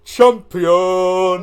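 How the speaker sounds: loud victory cry; triumphant shout. Real, not acted. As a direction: bellow it in triumph. Celebrating victory.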